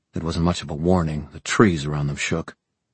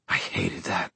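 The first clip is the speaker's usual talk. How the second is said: muttered; 7 dB quieter than usual. Acted. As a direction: mutter it softly to your wife. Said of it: "Helen muttered against Aline's neck".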